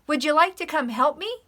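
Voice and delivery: high, loud plea; high eager hen. Acted. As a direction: call out hopefully.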